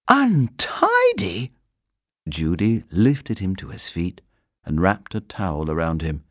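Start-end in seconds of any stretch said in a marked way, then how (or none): 0.1-1.5 high, slow disbelieving echo; incredulous. Acted. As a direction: repeat it in disbelief. Judy incredulous at the mess.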